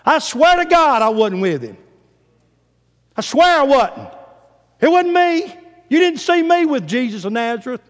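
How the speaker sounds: loud, high-pitched indignant denial; Peter swearing an oath. Acted. Peter denies to onlookers that he was with Jesus, swearing loudly. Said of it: "this time with an oath".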